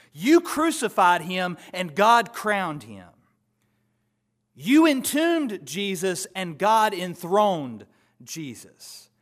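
loud, slow, soaring preacher's proclamation; fiery preaching. Acted. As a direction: hammer each contrast like a verdict. Preaching at full force.

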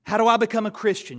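loud, quick, urgent asking; eager question. Retold or imitated. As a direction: burst out with the question. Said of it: "you might be asked".

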